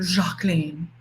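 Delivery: Jacqueline with a soft French J, "zhak-LEEN"; affected pronunciation. Pretend. Joking about changing her name, Jacqueline pronounced the French way. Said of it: "'cause I like saying that".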